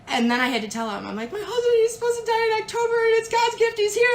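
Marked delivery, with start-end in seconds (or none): 1.3-4.1 high, fast, distraught pleading; panicked crying plea. Retold or imitated. Wife telling Stacy her dying husband is missing, distraught. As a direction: blurt it out as if sobbing in panic.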